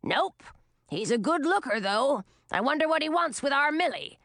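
put-on lightly raspy old crone; raspy old witch. A narrator's reading. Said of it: "replied in a raspy voice".

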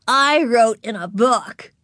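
put-on high, slow, loud little-girl boast; unashamed. A narrator's reading. Ramona confessing to the librarian, proudly unashamed. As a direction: declare it proudly like a small child. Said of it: "not the least ashamed".

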